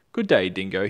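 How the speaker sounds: loud, sharp hello; snapping greeting. Acted. Platypus snaps a greeting at Dingo, loud. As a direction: snap the greeting out. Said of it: "he said, snapping his bill".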